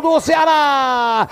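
long held goal scream; explosive goal commentary. Real, not acted. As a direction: roar the goal call, stretch it out. Goal call by narrator.

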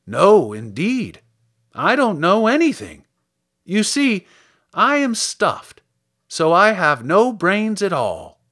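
high, loud, slow scarecrow lament; sadly. A narrator's reading. Scarecrow admits he has no brains, sadly. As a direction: draw out a hammed-up sorry confession. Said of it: "he answered sadly".

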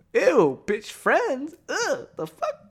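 high-pitched mock-offended recoil; disgusted disbelief. Guy reacting to being offered friendship, disgusted. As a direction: act out an appalled, high-voiced "ew".

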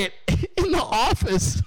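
can't stop laughing at the line; laughing through the quote. Real, not acted. Quoting the staffer about the office, laughing.

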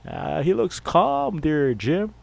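high, slow TV commentator drawl; announcer to his partner Jim, high and slow; sportscaster voice. Pretend. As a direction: call it like a booth announcer talking to Jim. Sportscaster commenting on Tom Landry.

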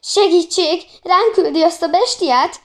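playful shrieking, pitched well up; mock shriek. A narrator's reading. Boys giggling as they cry for help from the beast, mock shriek. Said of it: "sikították a fiúk vihogva".

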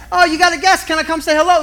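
high, eager neighbor dropping in; bright eager "can I come say hello". Retold or imitated. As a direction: chirp it like a nosy neighbor.

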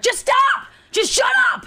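put-on high-pitched, pleading yell at the baby; exasperated shout. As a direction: snap in a high voice, at breaking point. Voicing parent at breaking point.

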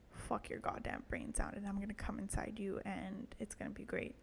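a soft, hammed-up bedroom whisper; mock seductive whisper. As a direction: whisper it like a pretend lover on a date. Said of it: "Am I pretending I have a penis now?".

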